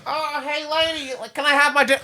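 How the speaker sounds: very high, comic little voice; pleading hawk character. Acted. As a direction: ask timidly in a squeaky cartoon-bird voice. Hawk politely asking for its dinner.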